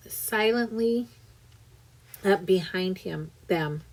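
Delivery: ordinary delivery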